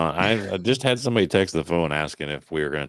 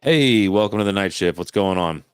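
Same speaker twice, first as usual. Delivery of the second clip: loud, upbeat call-in host; radio host greeting; 3 semitones higher, 6 dB louder than usual. Acted. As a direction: welcome the caller on air.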